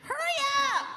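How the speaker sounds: very high mock screech; impatient dancer. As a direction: rush them in a hammed-up high voice. Dancer rushes the signer, acted.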